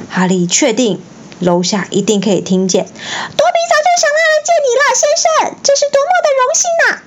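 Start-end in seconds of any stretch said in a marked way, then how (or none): 2.9-7.0 very high, fast elf voice; a tiny elf gushing at speed; squeaky house-elf. A narrator's reading. Dobby gushing to Harry, high and fast.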